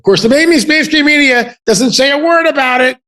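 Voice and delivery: high, loud, indignant complaint; exasperated rant, pushed up high; indignant outburst. Real, not acted. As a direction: raise pitch and volume, scornfully emphatic. Rants about silent mainstream media.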